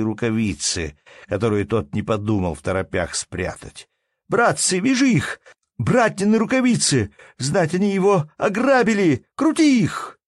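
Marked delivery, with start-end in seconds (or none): 4.3-10.2 high, loud cries rousing a crowd; frantic wailing. A narrator's reading. Merchant urges peasants to tie up robbers, wailing frantically. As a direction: scream for help to seize them. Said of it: "завопил неожиданно купец".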